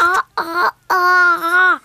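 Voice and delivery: straining to talk, mouth wide open. Acted. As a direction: say it with your jaw open wide. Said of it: "C'est très difficile de parler avec la bouche grande ouverte".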